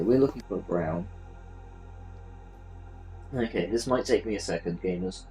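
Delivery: ordinary talk, nothing marked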